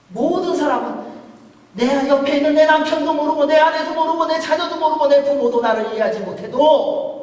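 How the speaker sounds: loud, high, rousing sermon climax; full-force preaching. Acted. Pastor proclaiming God's presence to the congregation, loud and rousing. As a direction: proclaim it to the back row.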